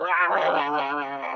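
ape a manager bellowing from the touchline; mimicking a manager yelling noise at his players; mock sideline shouting. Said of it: "he's going".

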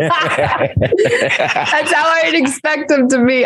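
talking through real laughter; a slow, laughing reaction. Real, not acted. Cohost cracking up at the impression, laughing.

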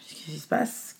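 Qu'est-ce qui se passe asked softly, almost to herself; quiet worried question. Retold or imitated. Mother in recovery room asking softly, quiet worry. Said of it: "je pleure, en fait".

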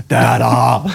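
mock enraged roar; forceful comic outburst; retold fury. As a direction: imitate a raging mother bear. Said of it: "hennes raseri".

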